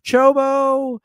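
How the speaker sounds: high, loud calls of the name; calling out a name. Retold or imitated. Nurses calling Chobo back down the well, high and loud. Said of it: "a bunch of nurses saying".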